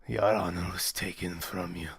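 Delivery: deep, quiet solemn voice; grave fighter. Acted. Fighter tells his rival of stolen honor, low and grave. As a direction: drop low and speak gravely.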